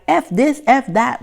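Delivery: high-pitched pretend swearing; mock cursing. Acting out someone cursing while holding a baby, mock swearing.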